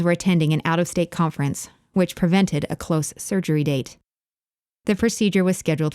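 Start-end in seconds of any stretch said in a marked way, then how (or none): none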